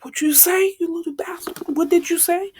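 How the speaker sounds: high furious shout; angry yell. Retold or imitated. Bakugo shouting at Deku, high and furious. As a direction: snap back with the pitch way up.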